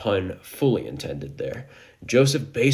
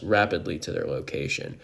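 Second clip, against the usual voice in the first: plain reading voice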